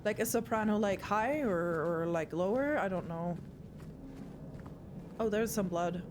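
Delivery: ordinary delivery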